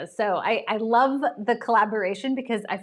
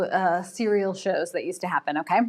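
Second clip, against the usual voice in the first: ordinary delivery, nothing marked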